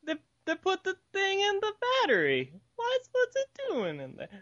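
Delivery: put-on very high slurred drunk voice; squeaky cartoon drunk. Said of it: "my drunk voice sounds vaguely like a Mario impression".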